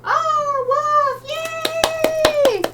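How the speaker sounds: very high, thrilled squeal; excited cheer. Real, not acted. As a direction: cheer for a friend's support. Cheers a viewer's subscription.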